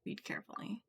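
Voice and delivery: hushed whispered warning. Real, not acted. Whispering to Ashley over the strange book. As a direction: whisper it close, like a secret. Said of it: "unvoiced whisper".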